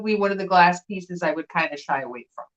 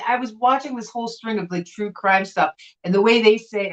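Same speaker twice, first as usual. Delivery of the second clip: ordinary talk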